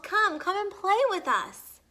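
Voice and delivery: high, loud cheerful call. Acted. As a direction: invite him over from a distance. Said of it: "they called".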